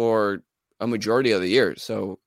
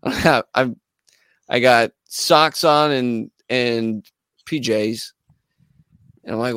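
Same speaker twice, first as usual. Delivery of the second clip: ordinary delivery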